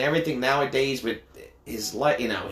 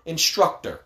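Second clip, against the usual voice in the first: ordinary talk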